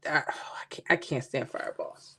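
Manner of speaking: a soft unvoiced aside of disgust; whispered gripe. Real, not acted. Player griping to viewers about fireballs, whispering. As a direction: confide your annoyance in a whisper.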